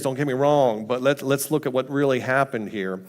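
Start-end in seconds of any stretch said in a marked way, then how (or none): none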